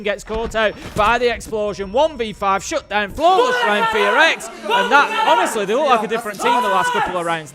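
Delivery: loud, high, fast excited call; excited shout. Real, not acted. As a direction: yell it as the round ends. Flawless round called at peak.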